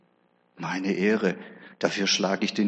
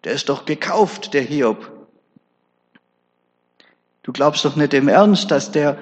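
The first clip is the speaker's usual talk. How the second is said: loud, higher, jeering outburst; a ranting devil who keeps building; devil shouting; 4 semitones higher, 6 dB louder than usual. Acted. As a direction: hurl the accusations at God, louder and higher. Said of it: "Dann schreit der Teufel raus".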